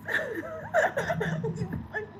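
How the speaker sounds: a high rising wet giggle; soft high laugh through crying; high teary laugh. Real, not acted. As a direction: laugh off the tears.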